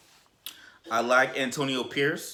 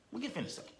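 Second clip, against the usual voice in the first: high, very soft, far-off repeat; hushed hopeful high repeat; hopeful near-whisper; 10 semitones higher, 10 dB quieter, much faster than usual. Acted. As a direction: breathe it out high and quiet.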